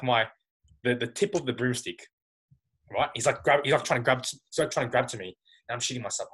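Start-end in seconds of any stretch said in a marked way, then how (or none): none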